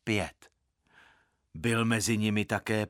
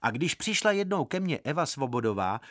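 ordinary delivery, nothing marked

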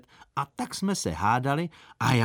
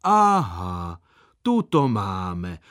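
loud, low, drawn-out jeer; mocking; 3 semitones lower, 5 dB louder, much slower than usual. A narrator's reading. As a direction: gloat slowly, as if catching someone out. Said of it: "by se mě všichni vysmáli".

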